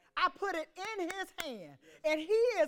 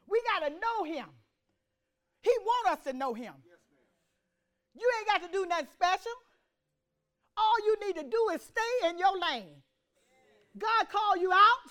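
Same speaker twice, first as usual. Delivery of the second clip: raised, punchy preaching with loud peaks; forceful preaching; 5 semitones higher, 6 dB louder than usual. Real, not acted. Preacher urging the congregation to stay in their lane, forceful preaching. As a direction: exhort the congregation in short bursts.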